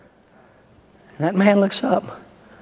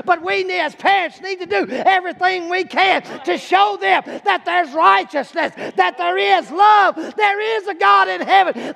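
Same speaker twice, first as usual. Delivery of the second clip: rising hellfire sermon, voice straining high; preaching at full force; 10 semitones higher than usual. Acted. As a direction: belt it out like a revival preacher. Preacher builds again to the congregation.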